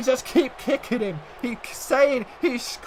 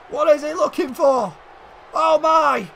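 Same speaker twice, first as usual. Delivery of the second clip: usual speaking voice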